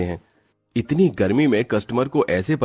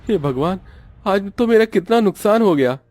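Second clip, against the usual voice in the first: higher, rising into a loud wail over the loss; a shopkeeper who climbs from shock to a loud lament; dismayed dhaba owner; 11 semitones higher, 3 dB louder than usual. Acted. Owner discovers spoiled food.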